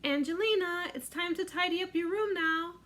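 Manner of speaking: lightly voiced mother calling her daughter. Mother calling Angelina to tidy up, lightly voiced call. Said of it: "mother was always calling to her".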